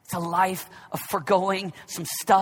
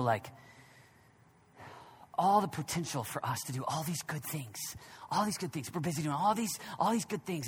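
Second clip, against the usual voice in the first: quiet reflective preaching; 3 semitones lower, 4 dB quieter than usual. Acted. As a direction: lower and soft, musing. Quiet reflective sermon passage.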